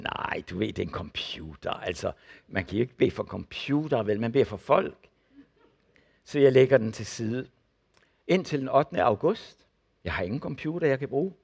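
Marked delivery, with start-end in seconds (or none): 0.0-5.1 much lower and softer, brushing her off; low, patient, mildly condescending explanation; dismissive husband. Retold or imitated. Husband dismisses wife's prayer offer. (lav, afvisende mumlen til konen)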